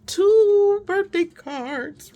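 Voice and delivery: overjoyed squeal of surprise; thrilled exclaim. Retold or imitated. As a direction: stretch it out like a child spotting a present. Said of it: "and I thought".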